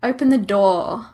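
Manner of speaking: usual speaking voice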